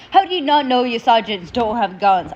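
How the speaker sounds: normal talking voice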